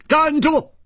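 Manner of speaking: a sudden commanding shout, high and loud; sharp shout. A narrator's reading. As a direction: bark the order to halt. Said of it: "一声大喝".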